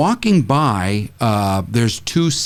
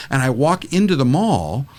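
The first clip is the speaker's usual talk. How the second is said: usual speaking voice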